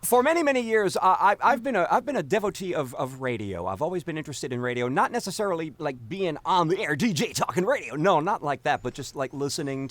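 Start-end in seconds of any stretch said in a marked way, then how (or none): none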